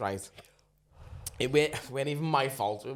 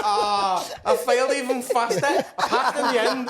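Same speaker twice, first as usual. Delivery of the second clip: higher, louder laughing telling; laughing through words; 10 semitones higher, 8 dB louder than usual. Real, not acted. Telling mates the punchline, laughing hard. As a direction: laugh while you tell it.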